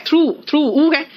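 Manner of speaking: through drawn out slowly with a long oo sound; saying through right. Shown as a demonstration. Teacher slowly models through for her students, with a long oo. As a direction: stretch through slowly so the oo is clear. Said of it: "थ्रू में ऊ है".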